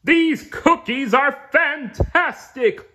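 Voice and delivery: high, slow jolly yell; high jolly Santa shout. Acted. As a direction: shout it across the sky like a merry Santa. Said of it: "heard him yell".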